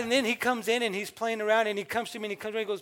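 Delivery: normal talking voice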